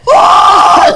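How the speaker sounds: high, loud startled whoa; loud mock whoa. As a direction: shout it out in surprise. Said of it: "I was like".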